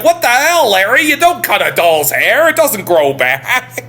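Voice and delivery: the dinner guests scolding Larry over the doll; mock outraged guests. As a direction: overdo the indignant scolding.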